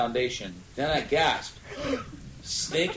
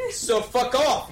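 angry shout; 12 semitones higher, 4 dB louder than usual. A narrator's reading. Narrator shouting at preps.

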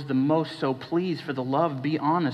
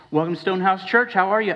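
louder, higher and fast, bright fake cheer; chirpy church greeter; 5 semitones higher, 4 dB louder, faster than usual. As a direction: greet them like a relentlessly upbeat usher.

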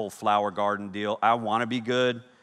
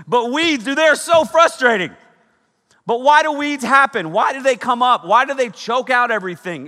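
high, loud frustrated questions; worked-up preacher venting about weeds; exasperated outburst; 12 semitones higher, 10 dB louder than usual. Real, not acted.

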